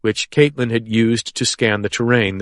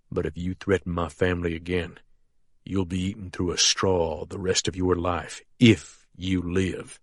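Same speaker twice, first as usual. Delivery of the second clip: soft menace; 4 semitones lower, 9 dB quieter than usual. A narrator's reading. Sheriff's soft threat continues.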